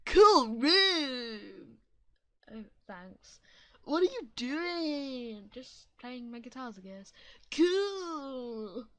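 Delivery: lightly voiced laid-back surfer dude. Kid Jim chatting to the guitarist in a light surfer dude voice. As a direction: drawl it like a stoked surfer. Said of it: "doing a Surfer Dude voice".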